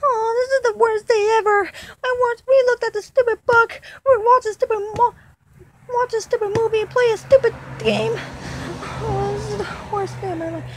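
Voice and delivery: put-on high childlike voice, whining through tears; a small dinosaur sobbing out his misery; crying little dino. Little dinosaur crying over bad day.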